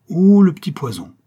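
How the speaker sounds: put-on old widow cursing her enemy in anger; angry outburst. A narrator's reading. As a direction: snap it out in fury. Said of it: "murmura la veuve Fipard avec colère".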